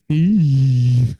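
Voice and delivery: low drawn-out cringing "ee" through the teeth; imitated crowd wince. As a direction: make the awkward sound of a crowd wincing. Crowd's wincing reaction imitated.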